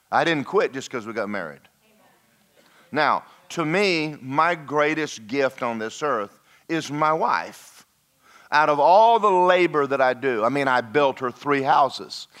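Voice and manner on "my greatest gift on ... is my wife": ordinary delivery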